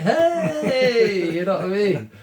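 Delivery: very high, loud, hammed-up greeting; mock-gushing hello. Artist mocks curated online cheer to the host, exaggerated excitement.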